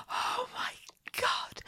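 ordinary delivery

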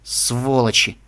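low, disgruntled grumble; grumbled curse. A narrator's reading. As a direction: grumble it out crossly. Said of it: "пробурчала она".

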